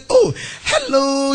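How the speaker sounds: high, drawn-out mock hello; slow, gushing pretend greeting; effusive phone greeting. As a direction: greet the caller with put-on delight.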